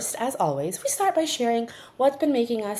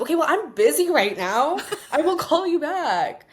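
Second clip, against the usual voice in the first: brushing off; 9 semitones higher, 4 dB louder than usual. Retold or imitated. Brushing dad off, retold.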